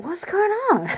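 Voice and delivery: high-pitched, incredulous question; puzzled squeak of disbelief; baffled mock outburst. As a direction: act out a baffled ex, pitch jumping up. Ex's baffled imagined thought.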